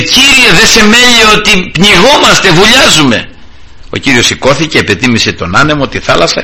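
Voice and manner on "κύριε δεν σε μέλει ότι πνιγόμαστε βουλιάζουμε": high, urgent acted plea; re-enacted cry of distressed disciples; mildly alarmed plea. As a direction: call out for help as if sinking. Acts disciples crying out in storm.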